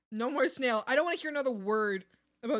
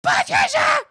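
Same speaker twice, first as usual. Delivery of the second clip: much higher and louder yell of the name; shout; 16 dB louder than usual. Real, not acted. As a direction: call her out across the room.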